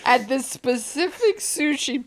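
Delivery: high, loud, laughing mimicry; laughing mockery. Cohost teasing the appointment slip, laughing mockery. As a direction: tease by repeating it through laughter.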